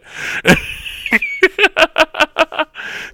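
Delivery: long high cackle; real, drawn-out giggling fit; laughing. Real, not acted. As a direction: burst out laughing at your own story. Laughs at own retelling.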